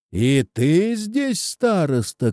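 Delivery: high, drawn-out hail; shouting. A narrator's reading. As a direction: hail an enemy, stretching the words. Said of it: "крикнул Живан". (протяжный оклик)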